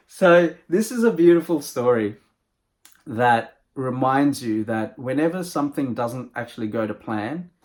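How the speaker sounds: ordinary delivery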